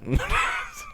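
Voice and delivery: shrieking burst of laughter; high laugh. Real, not acted. Laughing about the Fox News comments with a high shriek.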